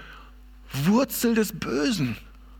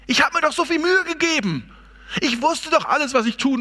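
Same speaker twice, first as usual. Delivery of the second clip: higher, pleading cry of someone who tried so hard; despairing Paul; 8 semitones higher than usual. Retold or imitated. Preacher voicing Paul's failure to his congregation, in despair. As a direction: voice Paul's despair as if it were your own. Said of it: "Total verzweifelt".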